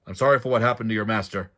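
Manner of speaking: loud, quick call across the woods. A narrator's reading. Joven calls out to the hidden bodyguard, loud and fast. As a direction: shout the apology out to him. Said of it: "Joven called".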